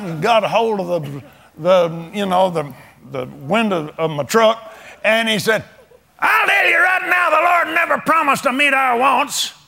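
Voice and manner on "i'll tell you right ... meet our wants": high, pushed mock holler; retold hollering. Preacher imitates a man at his truck window, hollering. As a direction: bark it at him like an angry churchgoer. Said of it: "Just hollering at me".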